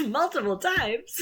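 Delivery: loud, high laughing shout; real cracking-up, high and loud; laughing outburst. Real, not acted. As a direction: burst out laughing on the words, drawn out. Bursts out between laughs.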